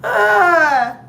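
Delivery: loud, high laughing burst; real laughter breaking out. Real, not acted. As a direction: crack up at the memory. Laughing about old relationship.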